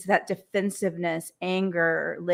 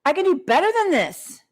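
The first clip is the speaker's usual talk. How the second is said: high, quick burst of passion and drive; passionate self-motivation; 10 semitones higher, 3 dB louder, faster than usual. Pretend. Speaker giving that kick in the butt, passionate and motivating. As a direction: fire yourself up, pitch leaping high. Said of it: "passionate and full of freaking power".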